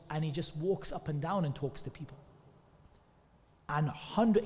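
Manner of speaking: ordinary delivery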